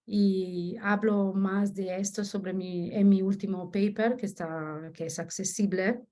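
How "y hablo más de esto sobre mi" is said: ordinary talk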